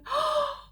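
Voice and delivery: high worried gasp. A narrator's reading. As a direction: catch the breath in alarm. Socks is gone.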